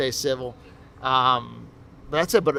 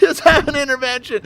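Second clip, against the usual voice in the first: higher, louder mock earnest quote; mocking boyfriend; 11 semitones higher, 8 dB louder than usual. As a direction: ham up the boyfriend's gentle suggestion.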